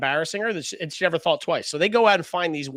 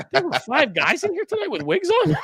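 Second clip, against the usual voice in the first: much higher, laughing disbelief; laughing surprise; 12 semitones higher, 4 dB louder than usual. Retold or imitated. Retelling the bar lady's compliment, laughing high surprise. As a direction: squeal it through a laugh.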